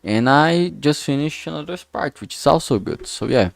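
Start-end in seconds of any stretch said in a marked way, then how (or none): none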